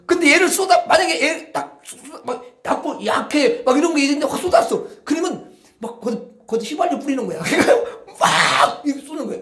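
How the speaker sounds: high, loud, heated telling; animated excited preaching; worked-up. Real, not acted. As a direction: rant about pouring out anger. Mocking angry outburst.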